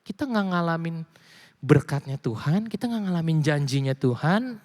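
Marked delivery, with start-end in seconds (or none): none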